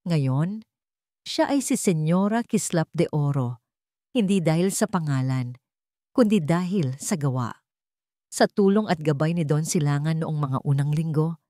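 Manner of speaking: ordinary delivery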